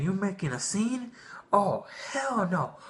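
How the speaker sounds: angry mom. Retold or imitated. Mother punishing son for the store scene, indignant. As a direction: scold like a fed-up mother who warned him.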